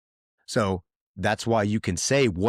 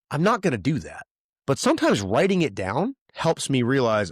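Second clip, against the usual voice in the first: usual speaking voice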